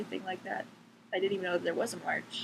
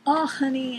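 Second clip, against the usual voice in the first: mocking condescending adult; 4 semitones higher, 6 dB louder, slower than usual. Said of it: "they talk to us like we're ten".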